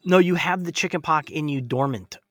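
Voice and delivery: normal talking voice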